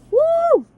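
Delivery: high, bright shake-off whoop; a sudden high woo to shake off the tears. Real, not acted. Composing herself after crying, letting out a high whoop.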